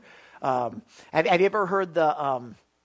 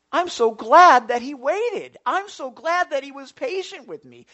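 fervent preaching; 9 semitones higher, 11 dB louder than usual. Real, not acted. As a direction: proclaim gratitude at full force. Preaching burst.

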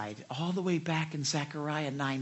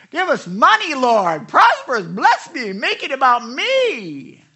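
high, loud, greedy pleading; hammed-up plea, much higher and louder; mock demanding prayer; 9 semitones higher, 8 dB louder, slower than usual. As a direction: cry out to God like a spoiled believer. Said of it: "They're thinking".

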